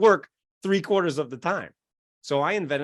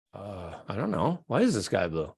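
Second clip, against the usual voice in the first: puzzled brain; 7 semitones lower, 3 dB quieter than usual. Acted. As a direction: wonder aloud, lost for the answer. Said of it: "your brain goes".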